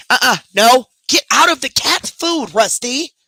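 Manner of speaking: loud, high scolding; scolding the dog. Real, not acted. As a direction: bark it at the dog to stop him. Scolding dog at cat food.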